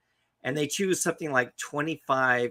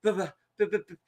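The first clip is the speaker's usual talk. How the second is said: high, broken mock stammer; panicked stutter; 7 semitones higher, faster than usual. Joe on his knees after meeting the bear, panicked stutter. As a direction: imitate someone too terrified to get the word out.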